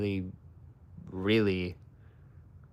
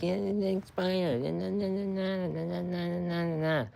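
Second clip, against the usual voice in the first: higher, airy sing-song brush-off; mock them with a high la-di-da voice; high mocking na na na imitation of a chatter; mocking dismissive troll; 9 semitones higher, 5 dB quieter than usual. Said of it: "comes at me like".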